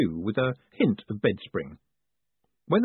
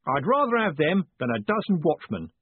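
higher, cheery coachman; happy; 6 semitones higher than usual. A narrator's reading. Coachman pleased with his hired mates, happy voice. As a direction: say it with happy approval. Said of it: "said Jim happily".

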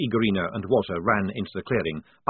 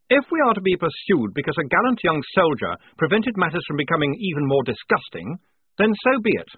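higher, louder noble declaration; high-minded officer; 7 semitones higher, 4 dB louder than usual. A narrator's reading. Lieutenant defending his young soldier, high-minded and resolute. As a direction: lift it to a defiant finish.

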